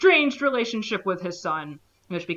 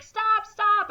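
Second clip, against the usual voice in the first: high, louder mock alarm; mock yell; 13 semitones higher, 5 dB louder than usual. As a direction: call out in a hammed-up alarm. Flight controller yelling stop.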